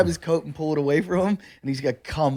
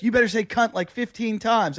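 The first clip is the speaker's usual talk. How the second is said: lightly voiced girlfriend, pitched up; imitated girlfriend; 6 semitones higher than usual. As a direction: make a raised-pitch demand as the girlfriend. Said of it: "his girlfriend comes up and goes".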